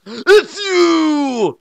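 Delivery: high, loud accusing shout; booming. Acted. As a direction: boom it so all Tokyo hears. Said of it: "Youngblood boomed".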